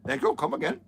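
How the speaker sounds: mock Indian shopkeeper accent; high, sing-song Indian accent. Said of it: "thick Indian accents like that".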